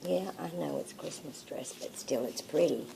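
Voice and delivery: quiet, soothing reassurance; soft soothing. Real, not acted. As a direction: gently talk her round. Soothing the dog.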